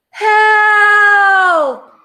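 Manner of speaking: high, loud shout of help; cry for help. A narrator's reading. Dish and Spoon calling from the beanstalk, high shout. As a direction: scream it from far above. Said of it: "came the cry".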